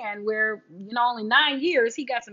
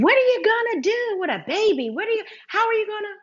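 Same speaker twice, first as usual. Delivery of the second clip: high, fast, urgent aunt voice; worried aunts; 10 semitones higher, 4 dB louder than usual. Retold or imitated. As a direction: rattle off the aunts' panic, pitched up. Aunts pressing again, acted quote.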